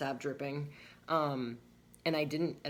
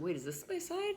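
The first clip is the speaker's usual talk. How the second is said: surprised question. Retold or imitated. Retold moment of realizing.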